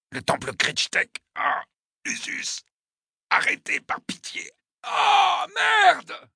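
high, strained gasps rising into screams; agonized pleading. Acted. Wounded attacker begging his torturer for mercy, in agony. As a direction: beg through stabbing pain.

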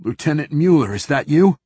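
sharp demand to be answered; snapped question. A narrator's reading. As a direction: snap it at a subordinate. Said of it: "voice from the back seat snapped".

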